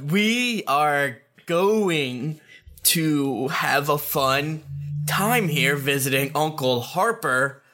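put-on very slow, drawn-out words for small kids; over enunciating. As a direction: spell it out slowly, like to a toddler. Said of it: "Why are you talking slow?".

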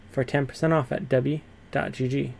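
ordinary delivery

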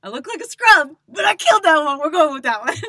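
loud high gloating; excited boast. Retold or imitated. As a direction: shout it like you won. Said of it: "I was like".

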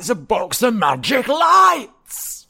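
high, loud, drawn-out cry of wonder; astounded shout. Acted. Skeleton sees a laptop for the first time, astounded. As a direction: marvel loudly, stretching the words.

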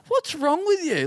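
leaving worker asked for dirt on the boss, replying with mock incredulity; incredulous. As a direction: drag it out in stunned disbelief.

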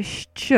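"sh" said softly as shch, sh running into ch; isolated opening sound. Shown as a demonstration. Teacher breaks down the first word, "sh" isolated as shch. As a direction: say "sh" as the shch in fresh cheese. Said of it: "it starts with a".

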